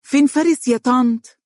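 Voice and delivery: put-on high, loud little child; bold, high-pitched kid's question. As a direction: pipe up loudly like a child. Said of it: "وهي تقول بصوت مرتفع".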